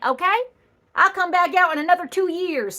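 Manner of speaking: ordinary delivery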